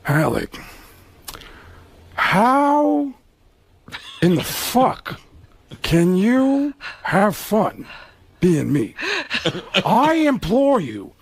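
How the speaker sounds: slow theatrical anguish, swooping up with long pauses; Al Pacino. Retold or imitated. Star begging his rival for answers, anguished slow impression. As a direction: implore him word by word, stretched and grandiose. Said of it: "Pacino looked at him and said".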